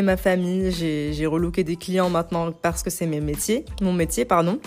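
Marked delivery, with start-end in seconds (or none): none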